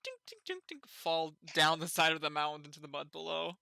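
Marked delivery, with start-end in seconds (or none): 0.3-0.9 imitated tinks. Marble sounds imitated.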